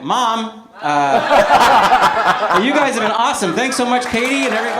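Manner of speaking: laughing and raising the voice; loud laughing toast. Retold or imitated. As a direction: call it out through laughter. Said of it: "I was like".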